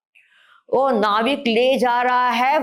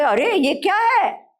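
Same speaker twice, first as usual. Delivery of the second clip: retold astonishment; 9 semitones higher than usual. Crowd astonished at the departing king.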